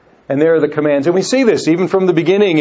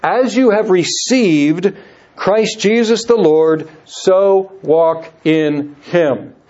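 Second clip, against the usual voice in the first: slow reading; slower than usual. Shown as a demonstration. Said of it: "this would not be a bad verse".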